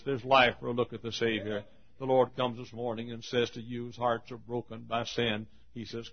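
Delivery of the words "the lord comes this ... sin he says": ordinary delivery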